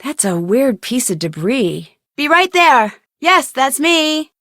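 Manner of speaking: usual speaking voice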